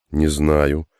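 usual speaking voice

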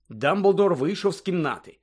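plain reading voice

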